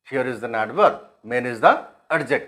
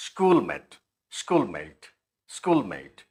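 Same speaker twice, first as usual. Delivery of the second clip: SCHOOLMATE strong on SCHOOL, falling away on MATE; SCHOOL-mate, a falling teaching example; stress on first syllable; 5 semitones lower, 4 dB quieter, much slower than usual. Shown as a demonstration. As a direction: hit SCHOOL, let MATE drop. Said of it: "school में है, अ, stress mark".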